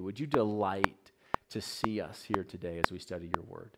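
soft, earnest spoken prayer. Acted. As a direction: pray over the room, gently. Pastor opens with spoken prayer.

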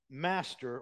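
ordinary delivery

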